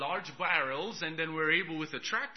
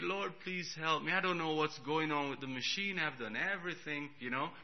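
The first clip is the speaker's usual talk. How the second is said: softer, earnest plea for help; quiet prayer; 7 dB quieter than usual. Retold or imitated. Preacher praying over the broken generator, softly. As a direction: pray it softly and sincerely. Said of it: "I prayed".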